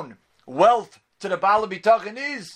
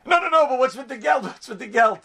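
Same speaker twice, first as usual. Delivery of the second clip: higher, hammed-up worried voice of the man; mock anxious protest; 5 semitones higher than usual. The rabbi retells the man's fretting to Reb Chaim, mock anxious and higher. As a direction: whine it like a man fretting only about his money.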